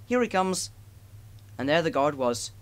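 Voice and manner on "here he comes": shouted. A narrator's reading. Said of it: "a little boy shouted".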